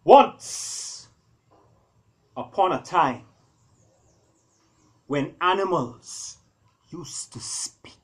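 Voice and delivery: loud, high start sinking to a slow whisper; once-upon-a-time hook fading to a whisper; fairy-tale opening. A narrator's reading. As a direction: open the tale big, then hush it.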